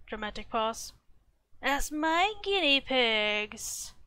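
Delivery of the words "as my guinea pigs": slow, loud, higher villain reveal; mustache twirling villain. Pretend. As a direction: savor it like a scheming villain. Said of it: "Cue mustache twirling".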